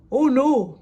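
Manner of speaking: loud, high-pitched alarmed cry; Ted realizing he's late, crying out. A narrator's reading. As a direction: blurt it out in alarm. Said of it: "cried Ted".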